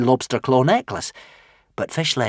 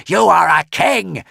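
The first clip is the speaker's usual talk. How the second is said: higher, louder angry roar; screamed; 9 semitones higher, 7 dB louder than usual. Acted. The dragon Furious to Hiccup, screaming angrily. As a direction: scream it at the boy. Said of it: "screamed the dragon Furious".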